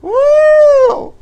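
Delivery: loud high whoop; shrill celebratory woo; pride scream. Acted. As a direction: scream it on cue. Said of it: "everybody has to scream, including me".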